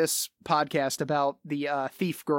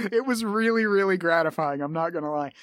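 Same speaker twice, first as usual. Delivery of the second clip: normal talking voice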